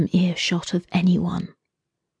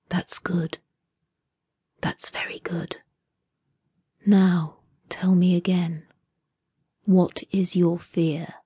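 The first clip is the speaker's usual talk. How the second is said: breathy whisper, much softer, unhurried; soft whisper in her ear; 7 dB quieter than usual. A narrator's reading. Captor whispering into the captive woman's ear. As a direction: lean in close and whisper menace. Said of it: "spoke softly into her ear".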